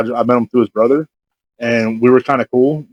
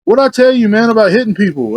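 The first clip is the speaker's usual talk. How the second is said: high-pitched mock reproach; scolding; 8 semitones higher than usual. Imitating a buddy telling him off after the slap, scolding. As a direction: take him to task, pitched up.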